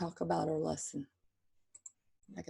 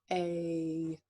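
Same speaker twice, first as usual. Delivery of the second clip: usual speaking voice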